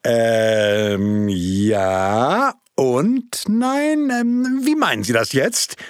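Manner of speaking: puzzled. Acted. Projectionist puzzled by answer.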